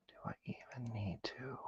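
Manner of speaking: voiceless whisper, puzzled at the lock; whispered inner thoughts. Retold or imitated. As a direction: ask the lock quietly, under your breath. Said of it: "and you're like".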